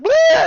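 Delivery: very high loud goofy cry; silly whoop. Retold or imitated. Two goofballs whoop over a Christmas tree, very high goofy cry. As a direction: whoop like a happy idiot. Bulk and Spike act like idiots.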